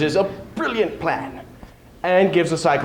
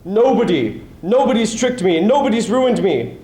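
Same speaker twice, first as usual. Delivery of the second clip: loud, higher, blinded giant's roar; roaring Cyclops; 7 semitones higher, 4 dB louder than usual. Acted. Blinded Polyphemus roaring back at his neighbours, loud. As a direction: bellow it out in pain and rage. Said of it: "Polyphemus roared in answer".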